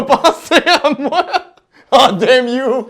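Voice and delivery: laughing. Real, not acted. Laughing at the dare.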